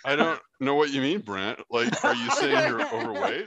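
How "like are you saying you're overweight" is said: much higher, laughing through the question; laughing tease. Real, not acted. Teasing the host about his weight, laughing. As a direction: tease him while cracking up.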